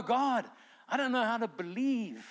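high-pitched, strained plea to God; hammed-up cry of doubt; acted desperation. Believer lacking faith pleads with God, acted desperation.

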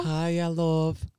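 cheery rehearsed greeting; practiced catchphrase. Pretend. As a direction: sing out the greeting. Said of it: "We've been practicing".

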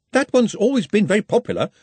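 high-pitched hesitant objection; doubtful. A narrator's reading. Ponder questions Ridcully's plan for the sport, doubtful. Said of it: "said Ponder doubtfully".

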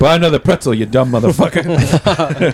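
high, slow, laughing mock scold; mock insult. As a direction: drawl a playful insult through laughter.

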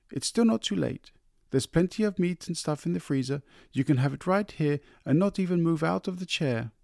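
high, light wife's voice; coaxing wife. A narrator's reading. Wife coaxing husband about supper, high. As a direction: coax him gently upward.

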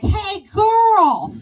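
loud, high mimicked holler; imitated kid's accent. Kid with a bike hollers at her, loud mimicked accent. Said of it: "And that's how he talks".

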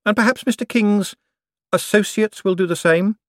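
high, eager banker politeness; Moist, polite banker. A narrator's reading. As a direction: keep the customer sweet. Moist speaks.

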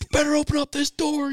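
high, loud demanding dad; hammed-up furious father; mock angry dad. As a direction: bang on the door and demand it.

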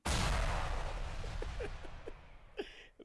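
high wheezing laugh. Real, not acted. Loud high laugh after teasing.

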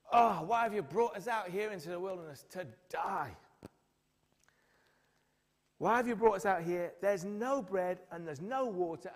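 loud, whiny grumbling; mock moaning complaint. Israelites complaining to Moses in the wilderness, loud whiny moan. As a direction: moan at Moses like a fed-up crowd. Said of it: "they moan and they groan and they complain".

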